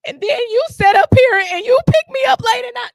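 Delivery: hammed-up heated complaint; shrill accusation. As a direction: voice jumps up in mock outrage. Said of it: "And then I'm like".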